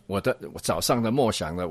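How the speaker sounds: ordinary talk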